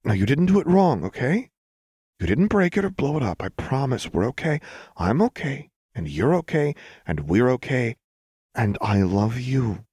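high-pitched, insistent comforting; urgent reassurance. A narrator's reading. Lux gathering upset Jinx in her arms, reassuring.

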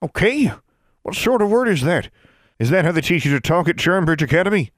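high, loud disapproving crone; scolding old woman. A narrator's reading. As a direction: scold like a strict great-granny.

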